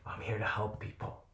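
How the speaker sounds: breathy, near-whispered promise to himself; whispered vow. Retold or imitated. To himself after crying, beating his chest, a whispered vow. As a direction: hush it out like a private oath. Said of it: "beat on my chest".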